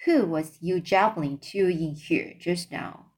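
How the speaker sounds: ordinary delivery, nothing marked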